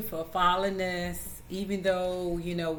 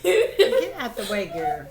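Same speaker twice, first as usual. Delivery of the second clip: loud laughing scolding; laughing exasperated; 6 semitones higher, 7 dB louder than usual. Real, not acted. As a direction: scold her through laughter. Daughter climbing on mother.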